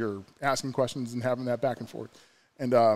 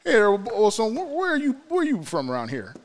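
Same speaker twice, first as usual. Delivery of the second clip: much higher, louder hammed-up Southern guy; a big Southern guy asking friendly questions, way up high; shitty Caleb Copeland; 11 semitones higher, 5 dB louder than usual. Caleb asking where the cook is from, high loud mock Southern voice. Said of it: "I did such a shitty Caleb Copeland".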